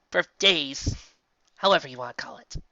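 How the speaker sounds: plain reading voice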